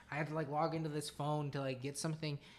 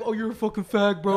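mocking bro voice; 3 semitones higher, 13 dB louder than usual. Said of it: "your friends will be like".

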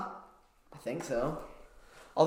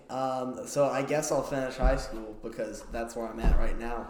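ordinary talk, nothing marked

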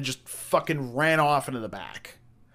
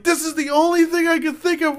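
high, loud, strained wail; imitated helpless crying; 8 dB louder than usual. Manager explaining his prank while cracking up, mock crying. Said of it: "And he is crying".

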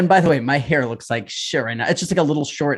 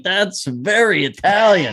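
a little higher and slow, amused; laughing mock quote; 5 semitones higher, slower than usual. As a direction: ham up his line, still laughing. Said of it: "He's like".

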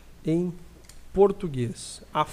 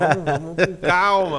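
laughing through the words, a bit louder; 8 dB louder than usual. Real, not acted. Co-host laughs while telling his partner to take it easy. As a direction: chuckle it out while calming him down. Joking about the year-end party.

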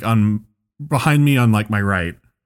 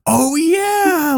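very high, gushing imitation; excited fan. Friendly fursuiter answering a startled stranger, imitated excitement. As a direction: gush like a delighted fan. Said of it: "nicest guy, he didn't even, he just went".

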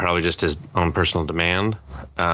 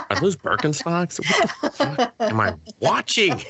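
high-pitched incredulous questioning; mock disbelief; 9 semitones higher than usual. Reacting to Ethan Hawke's costume, in high mock disbelief. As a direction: rise into comic, baffled protest.

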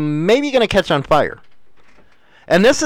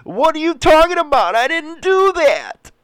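mock whining; 10 semitones higher than usual. Wife whining to her husband about the car, mock high whine. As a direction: imitate a whining, crying denial. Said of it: "start crying and whining".

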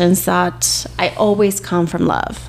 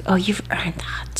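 whispering; 8 dB quieter than usual. Retold or imitated. Boyfriend's reply, whispered.

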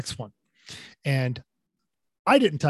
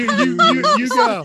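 mock squeaky urging; 6 dB louder than usual. Mocking the standoff at stop.

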